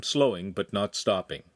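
ordinary delivery

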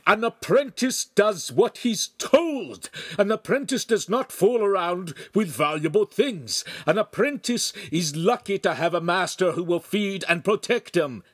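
loud, high-pitched tirade; furious screaming. A narrator's reading. Master lectures careless apprentice, screaming in fury. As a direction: hammer out the rules at a yell. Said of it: "glared at Lincoln and continued screaming".

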